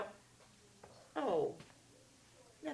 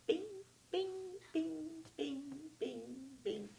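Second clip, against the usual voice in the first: magic bing sound; 9 semitones higher, much slower than usual. Acted. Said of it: "So he went".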